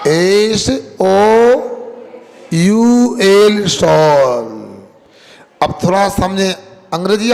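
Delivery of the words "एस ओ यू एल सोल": letters drawn out one by one, louder; spelling it out. Shown as a demonstration. Preacher spells soul letter by letter for listeners. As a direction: spell S-O-U-L very slowly to the crowd. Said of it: "उसकी spelling क्या होती है सोल की".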